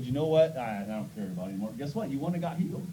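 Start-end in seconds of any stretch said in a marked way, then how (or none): 0.0-1.7 fast, soft dismissive quitting; understated quitter; giving up. Retold or imitated. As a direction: toss it away in disgust. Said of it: "and said".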